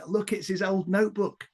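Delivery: high, put-on policeman's voice; constable gossiping. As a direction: play a bobby showing his mate a find. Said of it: "shot of the constables going there".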